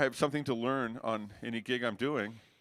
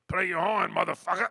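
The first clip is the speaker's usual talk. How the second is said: mock bandleader bark; 6 semitones higher, 4 dB louder than usual. Freddie barking at him on stage.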